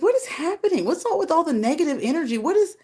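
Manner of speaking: high, fast, puzzled; can't believe the drama; baffled disbelief. Retold or imitated. Reacting to a trending video, high baffled outburst. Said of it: "I was like".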